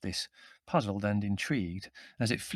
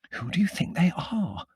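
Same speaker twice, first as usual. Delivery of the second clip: high, agitated stage whisper; 8 semitones higher than usual. A narrator's reading. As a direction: ask urgently in a high hushed voice. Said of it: "Margot whispered to me in agitation".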